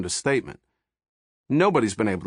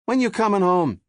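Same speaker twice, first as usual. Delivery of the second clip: whiny wife; 12 semitones higher, 5 dB louder than usual. A narrator's reading. Wife voiced on phone.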